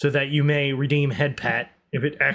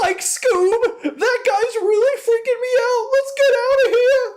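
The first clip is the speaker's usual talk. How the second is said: very high, squeaky cartoon fright; panicked cartoon sidekick; 5 dB louder than usual. Cartoon coward urging his dog to flee, high panic. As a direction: yelp it in comic terror. Cartoon catchphrase impression of Scooby's friend.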